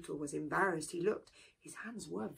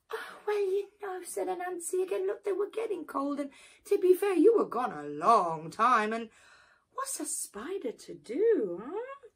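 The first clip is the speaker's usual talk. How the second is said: high, wheedling spider excuse; sly excuse; 9 semitones higher, 3 dB louder than usual. Acted. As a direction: shrug it off slyly.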